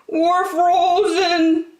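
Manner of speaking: very high, slow, drawn-out rabbit voice; tiny rabbit at the door, slow and high; shivering rabbit. A narrator's reading. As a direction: stretch it out like a frozen little rabbit. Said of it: "said the other".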